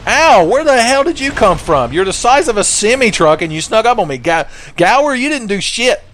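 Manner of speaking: high-pitched indignant yelling; startled outrage. Real, not acted. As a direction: jump in pitch and scold your useless protector. Startled by enemy, scolds his companion.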